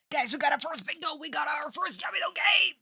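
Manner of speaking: thrilled high shouting; excited shout. Real, not acted. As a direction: yell it out in triumph. Celebrating first bingo.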